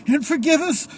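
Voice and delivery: high, loud tearful prayer; crying priest. A narrator's reading. Crying priest prays in the church, high and loud through tears. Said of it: "Priest crying".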